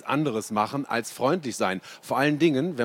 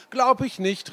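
put-on much higher disbelieving kid; a boy refusing to believe it; Lutz protesting; 12 semitones higher, 4 dB louder than usual. A narrator's reading. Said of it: "rief er". (Lutz ruft ungläubig, viel höher)

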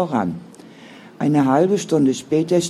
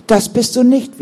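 fierce contradiction, much higher and louder; vehement; 8 semitones higher, 7 dB louder than usual. A narrator's reading. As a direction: cut her sister's self-blame off hard. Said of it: "widersprach Monika heftig".

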